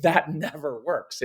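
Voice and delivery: ordinary delivery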